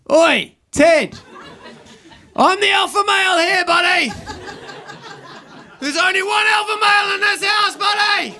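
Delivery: high, strained dominance shout; mock alpha male. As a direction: shout down the dog in a hammed-up high yell. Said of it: "I have to be the alpha male".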